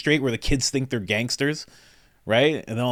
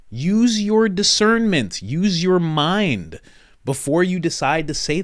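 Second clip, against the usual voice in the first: very slow, louder, each word weighed; slow emphatic advice; 6 semitones higher, 4 dB louder, slower than usual. Real, not acted. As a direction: lay it down slowly like a final lesson. Closing advice to viewers.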